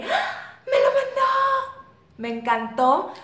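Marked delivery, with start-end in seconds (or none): 0.0-1.8 high squealing scream of joy, laughing; excited scream. Retold or imitated. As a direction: shriek with delight at the gift. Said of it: "lo vi, grité".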